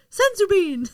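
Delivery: high-pitched gag call-out; mock cartoon cry; cartoon catchphrase. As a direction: pipe it up like a cartoon sidekick. Quoting Krillin gag from the show.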